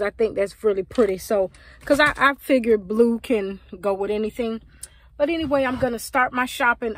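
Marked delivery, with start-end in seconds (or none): none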